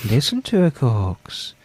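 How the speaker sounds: slow, droning mock-hypnotised voice; mock devotee. Co-host urging obedience to the Quarks, mock-hypnotic. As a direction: intone each word slowly, as a solemn command.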